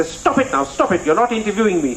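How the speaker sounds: high-pitched exasperated mother voice; imitating mum telling him off; scolding mother. Retold mother scolding son.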